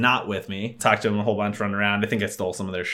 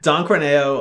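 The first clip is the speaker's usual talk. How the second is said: usual speaking voice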